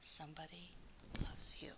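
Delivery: whispered tender words; hushed card. A narrator's reading. Narrator reading the secret valentine card in a whisper. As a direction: breathe the card's message.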